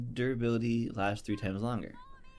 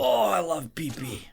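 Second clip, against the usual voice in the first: higher put-on voice; goofy creature voice; 7 semitones higher than usual. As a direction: gush like a dopey monster. Silly pee-pee bit.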